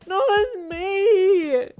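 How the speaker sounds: high, loud, long-drawn wail; hollered. A narrator's reading. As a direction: holler it through sobs. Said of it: "she hollered".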